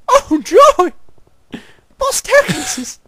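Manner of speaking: squeaky falsetto mock cheer; slow high sarcastic cartoon squeal.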